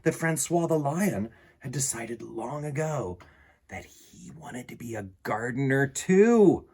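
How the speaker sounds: animated read-aloud with lively swings in pitch; children's story narration. A narrator's reading. Reading a picture book about a lion gardener to children, animated storytelling. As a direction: read it to children with playful, varied emphasis.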